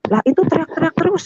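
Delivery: very high, insistent retold protest; alarmed protest. Woman protests that screaming continues, alarmed. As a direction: push back in alarm.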